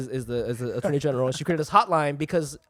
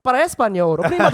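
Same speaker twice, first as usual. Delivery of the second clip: louder, higher Spanish menu prompt; hotline recording; 5 semitones higher, 5 dB louder than usual. Retold or imitated. Comic replaying the hotline's Spanish option, raised recorded-menu voice. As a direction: imitate an automated Spanish option. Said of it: "and it was like".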